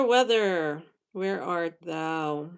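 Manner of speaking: usual speaking voice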